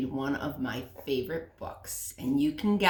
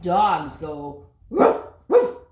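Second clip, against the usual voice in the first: big woof; 10 dB louder, slower than usual. Acted. Mother shows the puppy woof, loud. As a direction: bark like a dog, rising.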